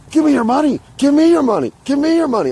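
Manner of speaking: high, loud, repeated aggressive demand; imitating a pushy stranger yelling; demanding shout. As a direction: bark it at him like a panhandler pushing hard. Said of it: "he's like".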